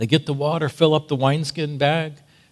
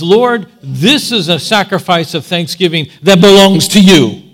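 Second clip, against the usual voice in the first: fervent prayer; 4 semitones higher, 10 dB louder than usual. Pretend. Offering thanks to the Lord.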